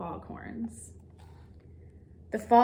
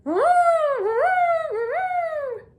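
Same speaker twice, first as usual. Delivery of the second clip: high, loud horn-like woo; hooting horn call; moose horn call; 6 dB louder, much slower than usual. A narrator's reading. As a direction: blast it out like a horn. Another horn-like sound heard.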